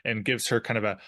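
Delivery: ordinary delivery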